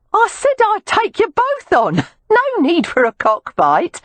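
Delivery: a brassy Cockney street woman hailing the men; Cockney call. Acted. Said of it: "called out in a Cockney accent".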